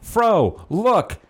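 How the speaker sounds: high, rushing mock excitement; playfully imitating an excited woman; acted excited announcement. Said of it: "you're like".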